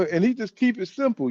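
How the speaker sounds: ordinary delivery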